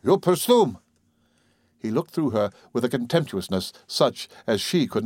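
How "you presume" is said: higher, mocking repeat of presume; scornful echo. A narrator's reading. Farmer sneering at her fancy word, scornful. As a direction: throw her word back with contempt.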